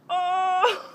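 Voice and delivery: high loud shriek-laugh; scream-laugh from the icy shock; shrieking laughter from cold. Real, not acted. Ice water dumped on head.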